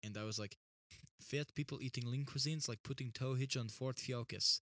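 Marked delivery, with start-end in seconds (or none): 1.2-4.6 deadpan mock Russian; put-on Russian accent for a joke. Retelling a joke told to sister, mock Russian accent. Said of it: "Russian accent thing going".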